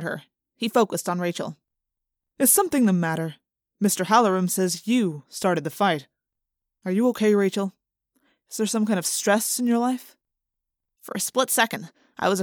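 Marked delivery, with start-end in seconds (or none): 2.4-10.2 concerned principal. A narrator's reading. Principal speaking gently to Rachel.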